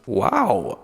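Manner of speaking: ordinary delivery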